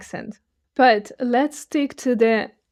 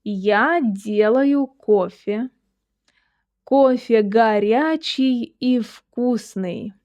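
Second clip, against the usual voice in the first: slow, drawn-out phrase for learners to repeat; measured language-teacher demonstration; slow model reading; slower than usual. Shown as a demonstration. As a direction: read it slowly, syllable by syllable. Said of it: "Try to focus on my articulation".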